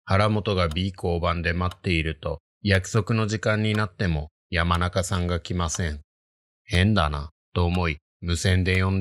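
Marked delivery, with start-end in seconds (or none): none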